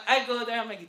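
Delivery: usual speaking voice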